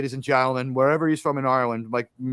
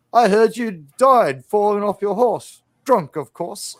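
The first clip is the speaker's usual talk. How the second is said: mock English gunfighter, higher and slow; English Bob; 5 semitones higher, 5 dB louder, slower than usual. Gunfighter taunting the sheriff, slow imitated English voice. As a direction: draw it out loud and high, in character. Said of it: "turns around, it's like".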